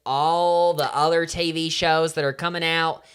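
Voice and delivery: honey-drawled Southern gossip host; mock Southern accent. Host teasing listeners about reality TV in mock Southern accent. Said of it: "My accent is getting so".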